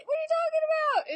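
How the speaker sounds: high, fast squeal of disbelief; shocked disbelief. Acted. Shaylee and Aiden react to the news, squealing in shock. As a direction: blurt it out like a stunned character.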